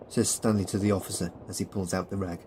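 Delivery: ordinary delivery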